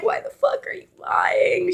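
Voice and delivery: high-pitched, incredulous panic; exasperated high-pitched disbelief; freaking out. Retold or imitated. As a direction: freak out at your sister without raising volume. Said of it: "like, freaking out".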